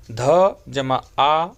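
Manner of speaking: slow letter-by-letter teacher spelling; patient classroom spelling of च plus अ; spelling out slowly. Shown as a demonstration. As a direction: build the word one sound at a time. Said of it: "इसी प्रकार से".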